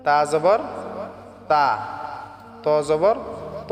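তা as light "taa", ত as heavy rounded "to"; careful model reading of তা against ত; correct distinct pronunciation. Shown as a demonstration. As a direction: keep thin তা apart from full, backed ত. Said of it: "হরফগুলি সহিভাবে পড়তে হবে".

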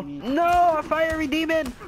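high, loud mock panic; alarmed cry. Enemy appears in game.

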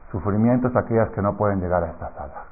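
ordinary delivery

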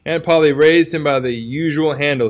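ordinary delivery, nothing marked

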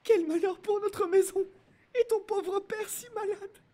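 very high falsetto lament; high-pitched woman's voice, overcome with dismay; distraught mother crying out. Acted. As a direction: wail over the family's misfortune. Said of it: "a crié ma mère".

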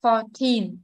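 put-on Fourteen with TEEN stressed; four-TEEN, second syllable prominent. Shown as a demonstration. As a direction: put the weight on the end of Fourteen. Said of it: "nhấn trọng âm số hai".

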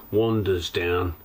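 ordinary talk, nothing marked

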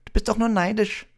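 put-on very high, piping boy; tiny high-pitched, loud protest; squeaking Colin. A narrator's reading. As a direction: squeak it out defiantly. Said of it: "piepste Colin".

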